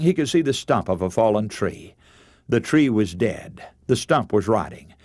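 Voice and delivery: ordinary talk, nothing marked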